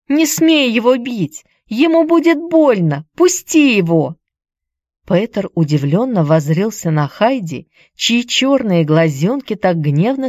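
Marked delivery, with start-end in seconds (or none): 0.1-4.2 put-on high, indignant girl's shout. A narrator's reading. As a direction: shout at him in outrage. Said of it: "крича". (возмущённый крик девочки)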